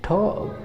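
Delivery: टॉक high and soft, t with a puff; टॉक as 'thawk', long 'aw'; aspirated 't'. Shown as a demonstration. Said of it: "ठा बोलेंगे". (टॉक को 'ठॉक' जैसा बोलें)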